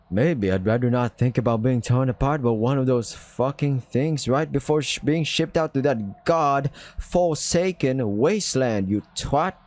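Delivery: lightly put-on London British accent with a sneer. Character insulting the others, in a light London British accent. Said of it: "Is that supposed to be, like, kinda, like, Londonish, British?".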